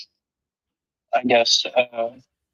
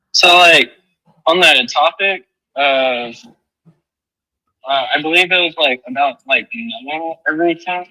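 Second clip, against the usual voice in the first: muffled; 3 semitones higher, 4 dB louder than usual. Real, not acted. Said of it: "you're a little muffled".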